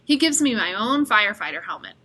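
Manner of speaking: usual speaking voice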